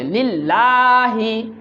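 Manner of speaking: লিল্লাহি with the লা held long; slow lengthened লিল্লাহি; stretched vowel. Shown as a demonstration. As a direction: draw out the middle vowel slowly. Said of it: "লিল্লাহর মধ্যে কিন্তু টান আছে".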